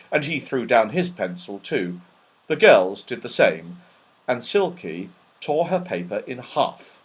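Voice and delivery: plain reading voice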